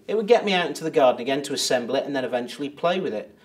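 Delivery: normal talking voice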